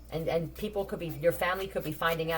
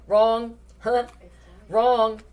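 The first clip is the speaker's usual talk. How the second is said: emphatic "wrong"; 4 semitones higher, 8 dB louder than usual. Real, not acted. Emphatic correction of a prediction.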